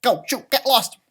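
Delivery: high, loud squeaky shooing; angry leprechaun. Acted. Leprechaun trying to shoo the dog off. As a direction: push the big dog away with your voice. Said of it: "He's like".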